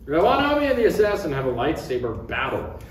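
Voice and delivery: ordinary talk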